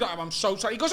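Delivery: ordinary talk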